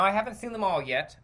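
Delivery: ordinary delivery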